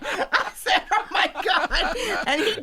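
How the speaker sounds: slow, high, laughing gasp; real laughter at a surprise. Real, not acted. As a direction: laugh out a stunned oh my God. Reacting to the title, laughing.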